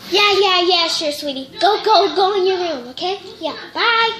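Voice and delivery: loud, high, rushed mom voice; dismissive mom. Acted. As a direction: brush the kid off quickly. Distracted mom brushes off daughter.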